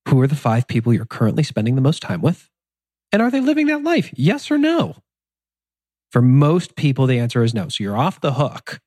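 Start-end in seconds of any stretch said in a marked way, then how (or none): 3.1-5.0 pointed question. Real, not acted. Pointed rhetorical challenge.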